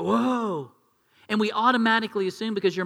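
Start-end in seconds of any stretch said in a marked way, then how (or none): 0.0-0.7 put-on impressed kids. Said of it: "all the other kids go".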